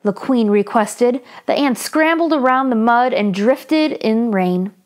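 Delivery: put-on lively read-aloud for children; story narration. A narrator's reading. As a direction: tell it brightly to young listeners.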